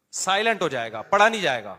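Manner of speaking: ordinary talk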